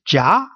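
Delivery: jia gliding upward; rising jia, like a question; second tone. Shown as a demonstration. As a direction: say jia with the pitch climbing to the end. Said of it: "The second tone is going upward".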